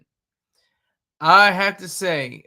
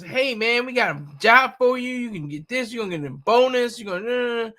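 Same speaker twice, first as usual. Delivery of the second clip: higher-pitched recruiter sales pitch; imitated recruiter; 5 semitones higher than usual. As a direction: pitch it like a recruiter selling the job. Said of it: "the recruiter's office".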